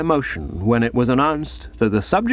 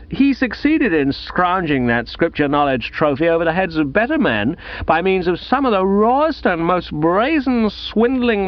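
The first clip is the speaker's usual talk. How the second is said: higher-pitched, emphatic public denunciation; Gussie, indignant accuser; 6 semitones higher than usual. Acted. Gussie tells the hall how Bertie cheated, high and indignant. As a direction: build a scandalised case, leaning on the key words.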